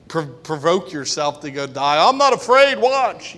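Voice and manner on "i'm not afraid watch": high, loud daredevil boast; hammed-up fearless boast; mock bravado. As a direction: show off like someone daring death. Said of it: "You should be willing to say".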